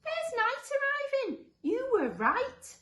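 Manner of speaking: very high, slow, loud wonder; high-pitched astonished concession; amazed monster. Acted. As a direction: marvel slowly in a high character voice. First monster amazed, admits being wrong.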